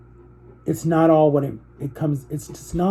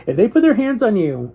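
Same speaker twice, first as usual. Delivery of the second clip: normal talking voice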